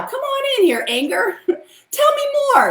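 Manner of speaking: high, playful welcome to anger, laughing through it; mock welcoming. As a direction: greet your anger like a guest, in a high laughing voice. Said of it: "invite 'em in".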